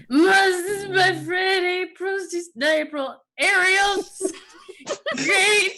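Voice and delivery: high, hammed-up sobbing wail; breaking down bawling. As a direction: blubber to mother like an overwrought child. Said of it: "just breaks down and just like".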